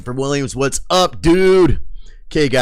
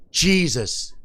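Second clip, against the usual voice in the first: normal talking voice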